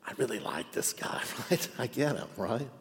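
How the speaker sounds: confiding whisper; hushed confession to the congregation; whispered aside. Real, not acted. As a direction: lean in and whisper it.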